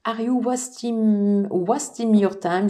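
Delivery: halting English in a French accent, restarting mid-phrase. Real, not acted. Tarot reader rereading an English card to viewers, French-accented English. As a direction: stumble slowly through English with a French accent. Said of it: "désolée pour mon accent anglais".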